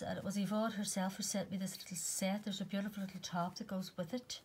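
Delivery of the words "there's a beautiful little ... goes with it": ordinary talk, nothing marked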